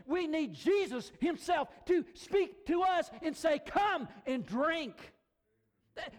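high, loud, emphatic sermon delivery; impassioned preaching. Real, not acted. Preacher urging people that they need Jesus himself, impassioned. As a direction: drive each phrase home at full force.

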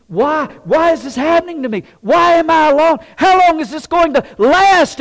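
loud, high, anguished wail of questions; hammed-up desperate whining; acted whining complaint. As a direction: cry out in protest to God. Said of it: "we want to just whine and groan".

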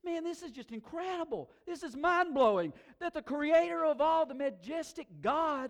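high, loud, awestruck proclamation; David. Retold or imitated. Psalmist amazed that the Creator notices him, loud awe. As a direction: build to a mind-blown shout of wonder.

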